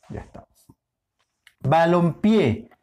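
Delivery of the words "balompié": slow balompié with the weight on final pié; stress on PIÉ. Shown as a demonstration. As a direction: draw out balompié and land on PIÉ. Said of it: "ahora es pie". (balompié lento, con acento en pié)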